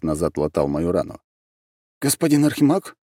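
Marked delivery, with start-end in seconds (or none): 2.0-3.0 high, rising worried question; taken aback, voice lifted high; surprised. A narrator's reading. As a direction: ask in startled concern, slowing at the end. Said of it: "удивился он".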